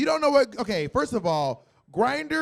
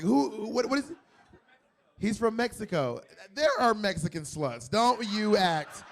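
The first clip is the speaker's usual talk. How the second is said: ordinary talk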